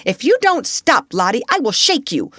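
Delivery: high, loud scolding; frantic, high-pitched warning; exasperated threat. A narrator's reading. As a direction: lose patience and threaten sharply. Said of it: "then in quite another tone".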